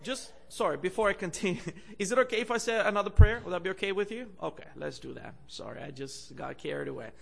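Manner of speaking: ordinary talk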